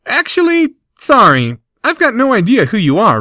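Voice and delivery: high, sheepish apologetic voice; sheepish admission. Acted. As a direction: apologize awkwardly. Harry's raised-pitch reply.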